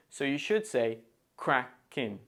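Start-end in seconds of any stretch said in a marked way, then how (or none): 1.4-2.2 CRACKING split in two, CRACK stressed. Shown as a demonstration. Teacher shows the stressed word, CRACK-kin said in parts. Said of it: "the stress falls on the word cracking".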